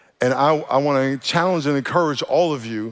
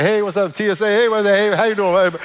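higher, louder, bouncy hellos; cheery greeting; 6 semitones higher, 4 dB louder than usual. Retold or imitated. Pastor acting himself greeting airport staff, loud and bright. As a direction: greet everyone like the mayor. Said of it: "I'm like".

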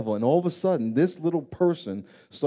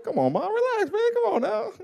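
much higher mock whiny protest; embarrassed son. Son brushing off his hugging mother, high mock-embarrassed protest. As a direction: squirm out of it, half laughing.

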